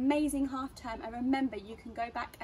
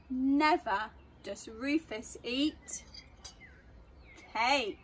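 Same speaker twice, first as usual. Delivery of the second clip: put-on reading to children; 2 semitones higher, 6 dB louder, slower than usual. A narrator's reading. Reads a rhyming story aloud.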